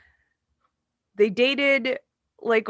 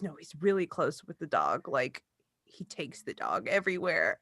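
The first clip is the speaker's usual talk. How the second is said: hushed mom; 6 semitones lower, 9 dB quieter than usual. Retold or imitated. Mom confides about her husband and the dog, hushed and low. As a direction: lower and softer, confiding. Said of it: "She goes".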